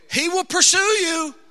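loud, high, emphatic declaration; full-force preaching. Acted. Preacher telling runaways God will pursue them, loud and emphatic. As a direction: proclaim it firmly to the congregation.

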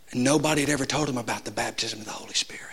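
soft, quick, near whisper; hushed aside. Real, not acted. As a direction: lean in and confide it. Confiding a striking detail.

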